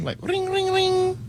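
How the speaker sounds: high squeaky ring ring; phone ringing imitation. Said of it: "a little trial run".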